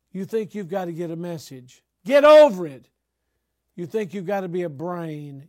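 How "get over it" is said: high, very loud outburst; explosive preacher's scolding; shouted rebuke. Acted. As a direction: shout it as a blunt telling-off. Repeats rebuke, escalating to shout.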